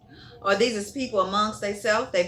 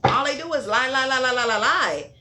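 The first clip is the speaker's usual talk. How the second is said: raised, insistent chant of repetition; emphatic accusation; 3 semitones higher, 4 dB louder than usual. Real, not acted. Tarot reader calling out liars, emphatic and insistent. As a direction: hammer the repeated word in a higher, louder run. Rattling off repeated accusation of lying.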